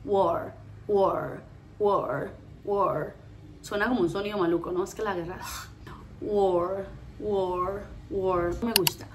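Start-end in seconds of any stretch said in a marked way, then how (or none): 0.0-3.2 "war" with rounded vowel gliding into a gentle R; "war" slowly, English R held lightly; soft R. Shown as a demonstration. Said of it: "luego la R suavecita". 6.2-8.5 "war" as "wo" plus a held English R; isolated, rounded "war" for learners; soft R. Shown as a demonstration. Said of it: "luego la R suavecita".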